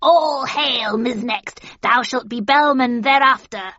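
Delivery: loud, high witch proclamation; witch hailing. A narrator's reading. Witches hail her.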